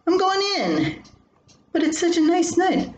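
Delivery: voiced high and nervous; alarmed high voice. Wife fleeing, Bob protesting.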